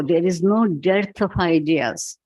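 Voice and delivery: ordinary talk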